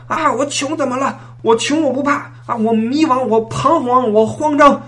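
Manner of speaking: high defiant shouting rant. Retold or imitated. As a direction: belt it out like a rock anthem. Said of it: "当众大喊".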